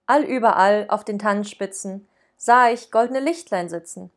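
measured, solemn recital with weighty stresses; poem recitation. Acted. Host reciting Storm's poem to co-host, recital. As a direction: declaim the Christmas poem like a school recital.

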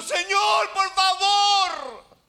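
full-force anguished shout, very high; long, wailing cry for mercy; desperate crying plea. Acted. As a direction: scream it as a begging mother, drawn out. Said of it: "Y llorando".

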